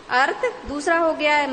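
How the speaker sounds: usual speaking voice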